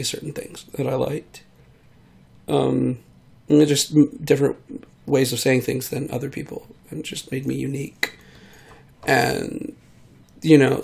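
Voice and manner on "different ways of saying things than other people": ordinary talk, nothing marked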